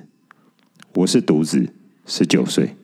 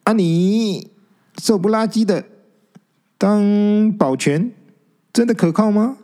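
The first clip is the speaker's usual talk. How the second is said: high, sceptical jab, slow on 当保全; indignant; 11 semitones higher than usual. Acted. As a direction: poke fun at the skinny guard, high. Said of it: "有点不服气". (不服气地高声挖苦)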